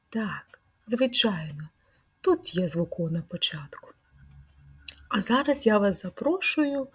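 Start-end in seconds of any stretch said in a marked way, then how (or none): none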